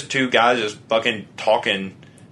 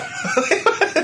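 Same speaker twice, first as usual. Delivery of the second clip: high laugh. Real, not acted. Laughing at the SNL casting joke, high burst of laughter.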